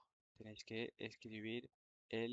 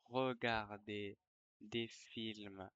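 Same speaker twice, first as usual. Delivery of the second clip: dictating. Shown as a demonstration. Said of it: "je vais dicter une dictée".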